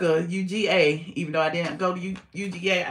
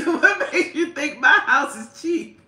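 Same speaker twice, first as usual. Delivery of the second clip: words pushed through laughter, higher and a bit louder; laughing; 6 semitones higher, 5 dB louder than usual. Real, not acted. Answering viewers about cheap Atlanta houses, laughing through words. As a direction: talk while still cracking up.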